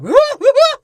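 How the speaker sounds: very high, loud monkey whoops; mock chimp celebration; imitated ape hoots. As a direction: hoot wildly like an excited ape. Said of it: "Rocket even puts up the bag of cookies".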